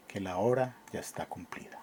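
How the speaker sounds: ominous whispered close. Acted. Death speaking to the lover, a grim whisper. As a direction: let death's last words fade to a whisper.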